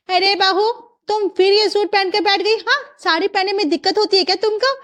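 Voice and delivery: high-pitched, accusing questions; scolding mother-in-law. Acted. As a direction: nag the bride about her clothes. Mother-in-law objects to suit.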